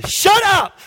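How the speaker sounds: sudden high, loud shout; shouted command. Retold or imitated. Jesus commanding the wind to stop, shouted. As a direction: fire the order out at full voice.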